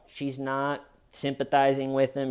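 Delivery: normal talking voice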